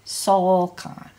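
"sol" held strong, "kan" lighter; teacher's model with SOL emphasized; stress on SOL. Shown as a demonstration. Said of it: "keep the stress on the sol".

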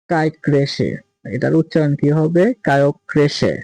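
ordinary talk

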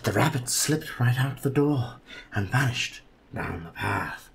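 slow, hushed spooky read; dramatic children's story narration; dramatic storybook reading. Acted. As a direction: read it like a creepy bedtime tale.

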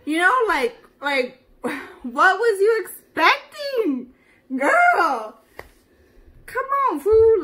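angry rant. Acted. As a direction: chew him out, voice climbing. Ranting at ex-boyfriend.

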